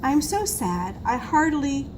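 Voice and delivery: usual speaking voice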